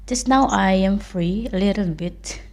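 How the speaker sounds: normal talking voice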